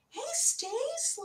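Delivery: high, hushed little voice. Retold or imitated. Coach as their own kinder inner voice, high and soft. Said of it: "listen to the softer voice".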